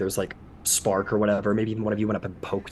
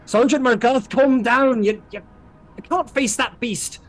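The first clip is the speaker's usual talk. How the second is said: high, loud, forceful appeal; urgent calming command; 10 semitones higher, 6 dB louder than usual. Acted. Castilian trying to calm the struggling sergeant, urgent shout. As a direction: shout him down to bring him to his senses. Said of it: "trying to calm him down".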